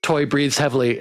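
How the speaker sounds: ordinary talk, nothing marked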